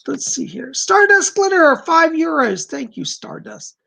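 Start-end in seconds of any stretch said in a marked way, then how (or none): 0.7-2.7 much higher and louder, thrilled to read out the donor's name; excited name call. Real, not acted. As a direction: announce the donation like a happy surprise.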